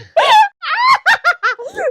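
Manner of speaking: high, loud witchy cackle; evil witch cackle. Real, not acted. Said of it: "that sounded like a cackle".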